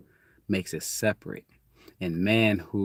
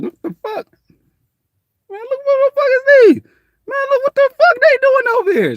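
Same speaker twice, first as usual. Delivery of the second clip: very high, loud squeal of disbelief; startled kid; 11 dB louder than usual. Retold or imitated. Kids in the neighborhood spotting an outsider, very high and loud. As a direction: shriek it like a kid seeing strangers.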